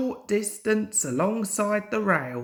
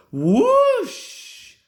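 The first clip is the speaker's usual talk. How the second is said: very high, loud rushing steam sound; steam whoosh; 8 dB louder than usual. A narrator's reading. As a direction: blast out the steam. Bert lets off steam.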